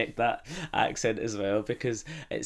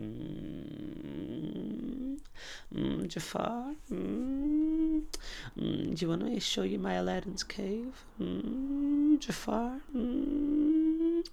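high, humming, suggestive villain voice; Jafar; 13 semitones higher, 3 dB quieter, much slower than usual. Acted. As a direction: croon it high with long drawn hums. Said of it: "Jafar, doesn't he?".

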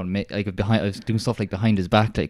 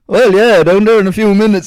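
high, loud bluffing into a phone; fake phone call; 11 semitones higher, 8 dB louder than usual. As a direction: pretend you're busy on a call. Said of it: "pull out your phone".

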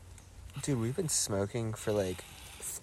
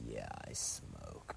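low breathy whisper, slow; deadpan whisper; 14 dB quieter, slower than usual. Retold or imitated. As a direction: murmur it offhand, like a confession. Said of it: "just be like".

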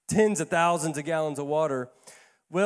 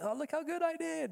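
smug delight; 11 semitones higher, 8 dB quieter than usual. Retold or imitated. Proud on seeing the house.

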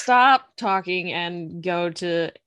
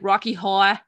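New York "Horror", second r dropped; New York accent. Retold or imitated. Quoting coworkers from New York, New York accent. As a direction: mimic a New Yorker's "haw-uh". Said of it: "coworkers from New York".

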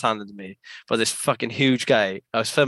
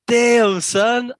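high, louder hype shout; hyped cheer; 11 semitones higher, 5 dB louder than usual. Retold or imitated. Tommy hyping a friend's trick, high cheer. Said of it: "Tommy just comes".

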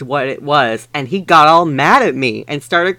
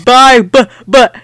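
mock grunt; 9 semitones higher, 8 dB louder than usual. A narrator's reading. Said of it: "he grunted".

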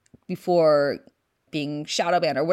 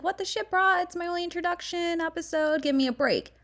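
mock outrage; 11 semitones higher than usual. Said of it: "And I was like".

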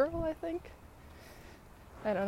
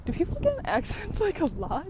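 surprised; 9 semitones higher, 7 dB louder than usual. Retold or imitated. Retold question, asking in disbelief.

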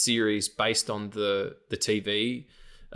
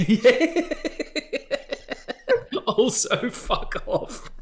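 laughing; 12 semitones higher, 3 dB louder, much slower than usual. Real, not acted. Laughing echo of insult.

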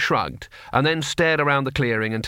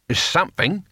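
put-on higher-pitched, sulky old warrior; 7 semitones higher than usual. A narrator's reading. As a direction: answer evasively, in a sulk. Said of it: "said Cohen sulkily".

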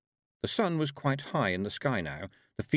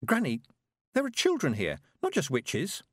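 worried Tiffany; 6 semitones higher than usual. Acted. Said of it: "Tiffany protesting".

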